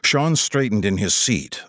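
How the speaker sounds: plain reading voice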